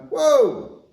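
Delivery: a little atom holding on to its electron; squeaky "whoa, not so fast" cartoon protest; protesting magnesium. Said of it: "Magnesium's going".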